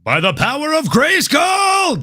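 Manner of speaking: a high, slow, roaring shout of the catchphrase; He-Man battle cry. Acted. Host answers his co-host with a He-Man battle cry, shouted. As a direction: belt it out like a cartoon hero raising his sword. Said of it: "I knew you were about to hit it".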